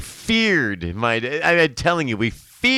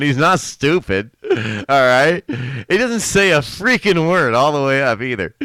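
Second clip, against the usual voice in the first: louder, higher, building the punchline; telling the best part with glee; excited storytelling; 4 semitones higher, 6 dB louder than usual. Real, not acted.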